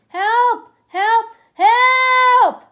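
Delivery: very high, loud repeated screams, last one longest; screams for help. Acted. Boy screaming to anyone outside, building screams. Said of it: "I began to scream".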